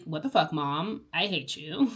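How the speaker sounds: low, soft mock-irritated retort. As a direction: imitate a sulky kid, low and under your breath. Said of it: "She gets irritated".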